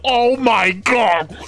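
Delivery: slow, drawn-out high shocked oh my God; stunned gasp. Retold or imitated. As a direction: stretch it out in disbelief. Friends shocked at self-inflicted haircut.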